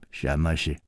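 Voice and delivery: low and soft; quiet, guarded query; cool question. A narrator's reading. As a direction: ask coolly, voice dropped. Yinji's cool curt question.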